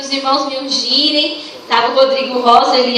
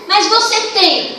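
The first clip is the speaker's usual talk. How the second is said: mock angry insistence; 7 semitones higher than usual. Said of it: "Se alguém chega bravo".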